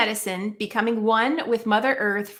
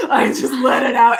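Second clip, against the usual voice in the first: laughing; 2 semitones higher, 9 dB louder than usual. Real, not acted. Telling the host about screaming in her car, laughing. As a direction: let the laugh carry the line.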